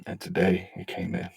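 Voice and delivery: usual speaking voice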